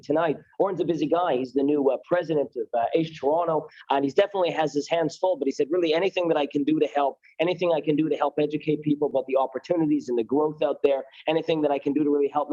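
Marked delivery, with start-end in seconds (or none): none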